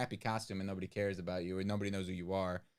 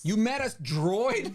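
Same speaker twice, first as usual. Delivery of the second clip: surprised; 12 semitones higher, 9 dB louder, slower than usual. Real, not acted. Amazed at droid meeting.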